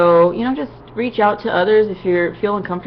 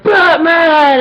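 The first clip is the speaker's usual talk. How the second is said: lightly imitated Batman, shouted much higher and louder; Kevin Conroy as Batman; 10 semitones higher, 9 dB louder than usual. Retelling how the voice actor shouted his hero's name to the crowd, loud mock Batman shout. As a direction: belt out the name like a hammed-up superhero. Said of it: "And he's like".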